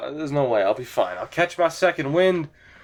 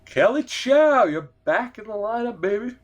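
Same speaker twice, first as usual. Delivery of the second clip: excited welcome; 7 semitones higher than usual. Acted. Welcoming player back to lineup.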